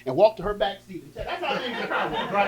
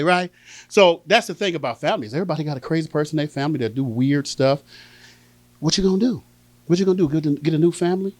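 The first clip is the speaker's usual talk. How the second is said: ordinary delivery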